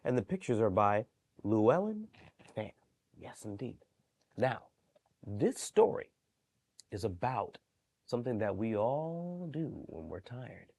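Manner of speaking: ordinary delivery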